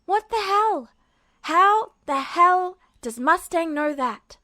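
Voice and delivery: panicked disbelief, held in; inner scream. A narrator's reading. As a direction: Edward screaming in his head, shocked. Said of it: "his mind screamed".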